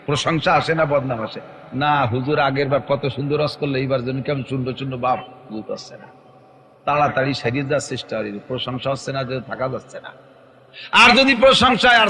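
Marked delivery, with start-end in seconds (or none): 1.7-10.1 dejected mutter. Retold or imitated. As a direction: lower and softer, trailing off, a touch of gloom. Preacher imitates listeners' lukewarm verdict. (প্রশংসা না পাওয়া বক্তার হতাশ নিচু গলা)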